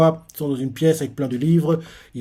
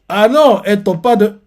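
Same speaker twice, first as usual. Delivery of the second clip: sudden correction; 5 semitones higher, 7 dB louder than usual. Real, not acted. Catches his own mistake.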